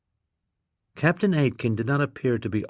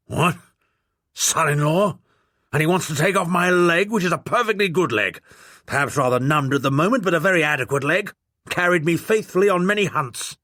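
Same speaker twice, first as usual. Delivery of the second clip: put-on blustering old lord; 6 semitones higher than usual. A narrator's reading. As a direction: bark it like an outraged old lord.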